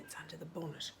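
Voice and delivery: hushed storytelling whisper. Shown as a demonstration. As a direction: whisper it to the little ones. Reading to children, hushed.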